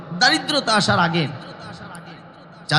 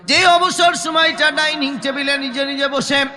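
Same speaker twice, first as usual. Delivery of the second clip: high, intoned lament; impassioned preaching; 7 semitones higher than usual. Acted. Preacher voicing regret over wasted free time, high intoned preaching. Voices regret at raised pitch.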